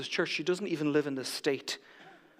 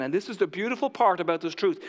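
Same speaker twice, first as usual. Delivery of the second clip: normal talking voice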